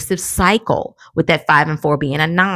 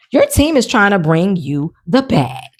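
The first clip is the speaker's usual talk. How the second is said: normal talking voice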